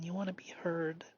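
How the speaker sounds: quick gentle whisper; a soft whisper to your own inner voice. Real, not acted. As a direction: breathe it out to yourself. Whispered to self.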